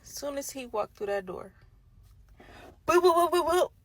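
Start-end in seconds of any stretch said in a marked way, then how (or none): 2.8-3.7 high, loud mock attack sound; loud, high comic sound effect; rapid-fire "boo-boo" noise. Imitated noise as he walks in.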